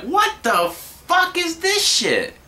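hammed-up shocked worker; mock angry boss. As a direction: parody the furious owners. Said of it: "they're like".

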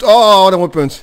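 loud, high cry of delight; excited shout. Real, not acted. Player cheering his own great point, loud and thrilled. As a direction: really shout out the joy.

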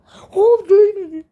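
high, loud cry of disappointment; frustrated yelp. Real, not acted. Gamer missing the target, loud crying yelp. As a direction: yelp as the shot just misses. Near miss in the game.

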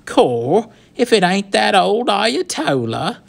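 loud, high, drawn-out taunt; mock him in a rough British street accent; sneering bully calling out a nickname; jeering gang lad. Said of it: "then twisting into sniggering laughter".

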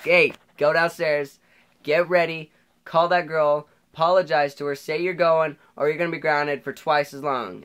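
imitating mom's loud, high commands; in-your-face orders, phrase by phrase; mad mom. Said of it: "getting all mad at me".

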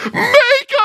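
very high, loud mock wail; weeping little girl. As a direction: blurt it out as a hammed-up bawl.

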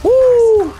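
high, loud "woo"; excited whoop. Real, not acted. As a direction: let out a piercing whoop of triumph. Celebrating during a game.

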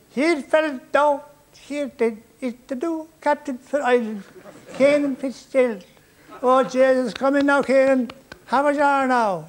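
high, loud Irish welcome; hearty Irish accent. Retold or imitated. Officials greet the new captain, in a high, loud Irish accent. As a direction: boom it out like a jolly Irish official.

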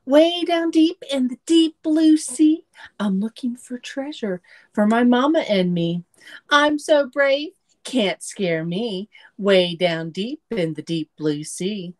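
sing-song storybook reading; reading to children. A narrator's reading. Miss Chris reading a picture book to children, sing-song. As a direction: lilt it for little listeners.

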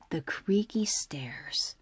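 whispered creepily; spooky whisper. Acted. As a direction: breathe it like a secret. Spooky story climax.

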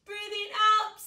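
loud hissing breath out; snake hiss. Shown as a demonstration. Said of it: "make a hissing sound like a snake".

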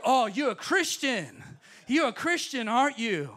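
loud, drawn-out mock accusation; jeering, stretched-out taunt. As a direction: imitate a heckler taunting you, high and loud. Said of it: "He's mocking me".

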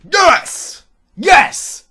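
loud high yes, overjoyed; victory yell. Real, not acted. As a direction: shout it out in triumph. Still celebrating win.

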